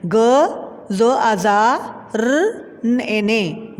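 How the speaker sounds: slow, drawn letter-by-letter spelling. Shown as a demonstration. Teacher spelling a word for pupils, slow and drawn out. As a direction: sound out each letter slowly with long gaps. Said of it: "गुजारने".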